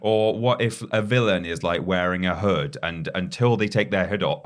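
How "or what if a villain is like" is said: ordinary talk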